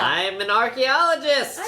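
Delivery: high, playful outburst of self-justification; emphatic exclamation. Real, not acted. Answering co-host's disbelief, emphatic exclamation. Exclaims his credential in mock protest.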